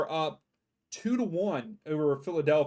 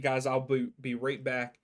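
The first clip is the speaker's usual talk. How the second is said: ordinary delivery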